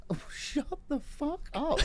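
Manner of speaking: very high, slow mock snap; hammed-up irritated reply; exasperated retort. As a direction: drag it out fed up. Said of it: "looking at him like".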